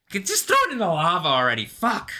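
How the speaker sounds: loud, high, rushed complaint; exasperated outburst. Real, not acted. Speaker fed up, wanting it in the lava, exasperated. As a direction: burst out fast and loud, ending on a high curse.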